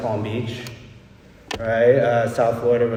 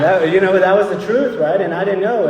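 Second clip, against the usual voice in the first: laughing; 7 semitones higher, 5 dB louder than usual. Real, not acted. Laughing after his own joke.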